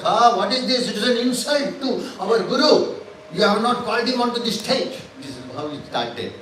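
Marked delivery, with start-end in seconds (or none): 0.0-5.0 raised, indignant protest on behalf of the Guru; stage an offended outcry over the slight; hammed-up outrage, a little louder; mock indignant disciples. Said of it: "he had asked his disciples to be angry".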